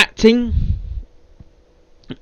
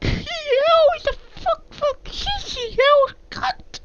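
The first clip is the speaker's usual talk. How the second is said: high-pitched swearing rant; 4 dB quieter, slower than usual. Real, not acted. Ranting swear burst after the ending.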